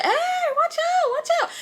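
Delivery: high alarmed yell; panicked warning. Retold or imitated. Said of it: "I said".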